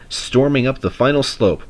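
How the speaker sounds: ordinary delivery, nothing marked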